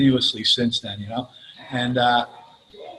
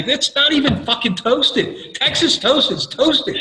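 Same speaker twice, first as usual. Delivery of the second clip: laughing exasperated rant; 7 semitones higher, slower than usual. Retold or imitated. Ranting about Texas toast, laughing.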